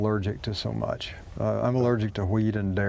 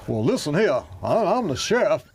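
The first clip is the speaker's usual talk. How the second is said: fake Southern accent; 5 semitones higher than usual. Mocking a Hollywood actor's fake Southern accent, hammed-up. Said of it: "he's doing that accent that doesn't exist".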